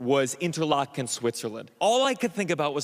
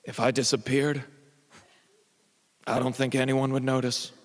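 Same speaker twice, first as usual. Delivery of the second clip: gloomy instructor; 3 semitones lower than usual. Retold or imitated. Suicidal instructor's gloomy line.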